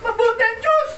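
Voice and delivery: very high, crying proclamation; tearful exclamation. Retold or imitated. Poor crippled friend crying out to Rodel that God is good, very high. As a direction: cry it out with tears of faith.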